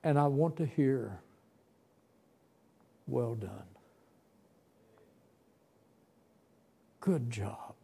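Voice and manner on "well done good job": soft unvoiced whisper, barely breathed; whispered praise. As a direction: breathe it as a longed-for answer. Longing to hear God's praise.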